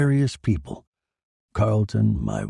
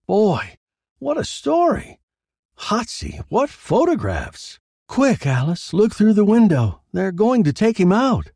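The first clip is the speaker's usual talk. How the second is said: excited reporter; 12 semitones higher, 4 dB louder than usual. Acted.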